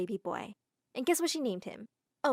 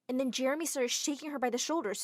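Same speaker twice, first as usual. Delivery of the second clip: ordinary delivery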